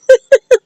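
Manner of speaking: high, loud burst of laughter; laughing in disbelief; amused laugh. Real, not acted. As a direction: crack up briefly. Amused at the character's behaviour.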